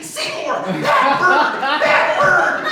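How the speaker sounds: high, loud frantic shriek. Retold or imitated. Owner charging in, shrieking at her bird. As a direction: scream at the bird in alarm.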